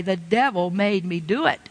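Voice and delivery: ordinary talk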